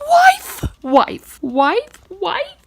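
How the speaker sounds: loud, high repeated shrieks; scandalised shouting. Real, not acted. Reader reacts to the father's suggestion, shrieking wife repeatedly. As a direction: yell it over and over, appalled.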